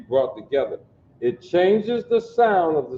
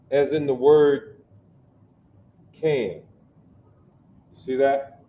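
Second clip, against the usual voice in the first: ordinary talk, nothing marked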